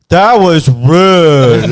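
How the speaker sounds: loud, high, very slow rebuke; hammed-up wounded reproach; offended customer. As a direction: draw out each word in mock offence. Voicing the offended drunk customer.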